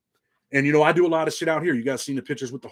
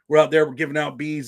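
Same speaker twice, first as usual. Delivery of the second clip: normal talking voice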